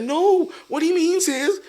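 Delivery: laughing over-the-top American voice; mock American accent. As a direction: exaggerate the American translation with a laugh. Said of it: "I was like".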